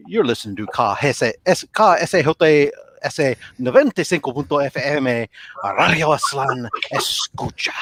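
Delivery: rolled Spanish station ID ending in a whisper; Spanish-language radio announcer. Retold or imitated. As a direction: announce the station with flair, whispering the final word. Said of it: "do the Spanish language show".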